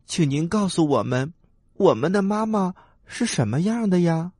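high, pleading little tadpole voice; small, high, questioning voice. Acted. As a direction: call up high and politely, like an eager little one. Tadpoles ask mother duck.

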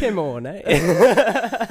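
put-on high, loud, drawn-out English accent; hammed-up English accent, breaking into laughter; mock English accent. Mock protest after accent criticized.

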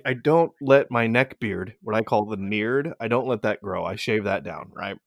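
usual speaking voice

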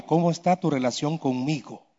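low, soft, insistent question; lightly voiced God, gently repeating; God speaking inwardly. God pressing past the preacher's works, low and soft. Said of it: "Dios me dijo".

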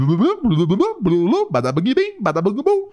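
loud, high nonsense babble; silly babbling. Pretend. Host babbles nonsense before getting started, silly babbling. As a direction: burble bouncy gibberish syllables with comic energy.

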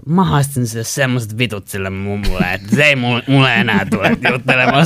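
put-on a slightly drunk woman boasting; tipsy neighbour. Tipsy woman bragging about telling the grandma off, loud. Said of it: "se toinen sanoi".

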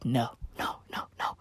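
fading whispered no's; whispered take-back. Real, not acted. Comic retracting a joke about Allah, whispering. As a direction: hastily whisper it away.

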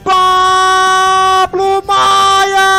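name stretched in a long high yell; explosive goal commentary. Real, not acted. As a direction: drag out the scorer's name at full voice. (nome do artilheiro gritado e esticado)

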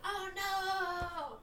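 high, loud reenacted cry; mock dismay. Viewer reenacts thinking a character died, mock dismay. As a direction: act out a drawn-up cry of dismay. Retelling own dismayed reaction to death.

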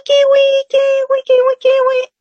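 very high, head-voice kiwi, kiwi; high voice demo. Shown as a demonstration. Teacher demonstrating the high tone to her students. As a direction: sing out kiwi up in the head register. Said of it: "es un tono alto y va a ser así".

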